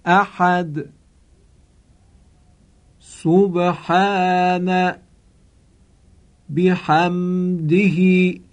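very slow, slightly chanted words for the class to echo; slow recited repeat. Shown as a demonstration. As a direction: lead the class to repeat each word.

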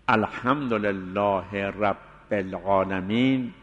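الحمدلله رب العالمین recited slowly, a short pause after لله before رب; slow recitation with pauses. Shown as a demonstration. Teacher to students reciting, slow with a short break after لله. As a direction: recite الحمدلله رب العالمین word by word with small gaps. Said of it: "باید یک مقدار مکث بشه".

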